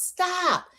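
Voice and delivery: sharp high mock scream of stop; high mock yell; imitated scream. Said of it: "screaming at the top of her lungs".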